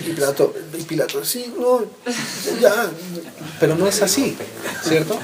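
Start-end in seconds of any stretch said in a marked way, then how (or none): 1.2-2.9 a lightly voiced, high, wavering Pilate; dithering Pilate. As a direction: sound like a ruler who can't decide. (Pilato indeciso, con voz alta y vacilante)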